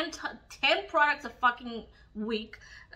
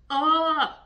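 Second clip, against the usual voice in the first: disgusted groan; 4 semitones higher, 8 dB louder than usual. Real, not acted. Disgust at diet food.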